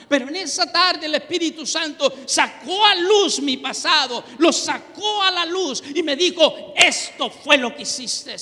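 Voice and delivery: a fiery pulpit testimony; fervent preaching. Acted. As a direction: preach it out with full force. Testimony preached at full force.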